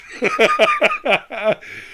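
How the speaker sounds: a sudden high shriek of laughter. Real, not acted. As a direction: burst out in a squealing cackle. Remembering dude told me.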